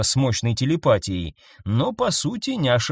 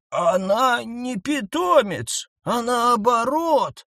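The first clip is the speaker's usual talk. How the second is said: put-on old cheetah voice; 10 semitones higher, slower than usual. A narrator's reading.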